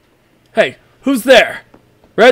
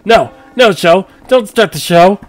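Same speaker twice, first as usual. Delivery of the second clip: louder, higher frantic protest; panicked shouting; 6 semitones higher, 6 dB louder than usual. Acted. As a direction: yell to stop the show. Panic as the show starts.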